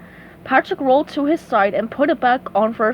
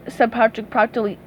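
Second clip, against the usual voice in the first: usual speaking voice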